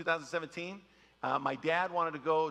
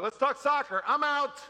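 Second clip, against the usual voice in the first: higher, louder mock enthusiasm; mock soccer fan; 9 semitones higher, 6 dB louder than usual. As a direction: pitch it up like an eager fan. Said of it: "they're like".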